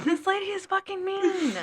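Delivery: exasperated high rant. Real, not acted. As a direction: vent it up high and emphatic. Venting about rude coworker.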